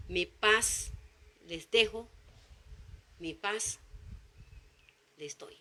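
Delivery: soft and low, fading almost to nothing; tender quote of the Lord. Acted. Preacher voicing the Lord's promise, tender and fading to a hush. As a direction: hand them peace gently, letting the voice die away.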